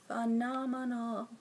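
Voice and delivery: soft, slow phenomenal; hushed awe. Real, not acted. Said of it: "repeats word".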